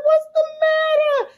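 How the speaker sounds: high, pleading, bewildered; pleading daughter. Retold or imitated. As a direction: beg him to tell what's wrong.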